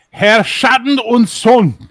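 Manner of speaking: loud, high, slow German proclamation; mock German announcer. Renaming friend's show, loud mock German announcement. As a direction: proclaim it grandly in a German accent.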